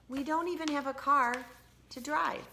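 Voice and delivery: gently explaining mother, voice lifted; Tina's mom. Acted. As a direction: mom telling Tina there's no bridge, patient. Mom voice continues.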